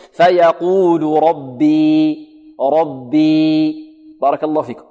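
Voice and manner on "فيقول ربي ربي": "ربي" ending in a held long ee, two counts; slow recitation, final ي of "ربي" prolonged; stop on long ya. Shown as a demonstration. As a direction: stop on "ربي" with the ee sustained level. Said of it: "نقف عليها بحركتين".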